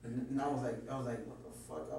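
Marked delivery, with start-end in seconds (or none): 1.3-1.9 breathless whisper, much lower; whispered disbelief. Retold or imitated. A scared man whispering to himself as the stranger approaches, hushed.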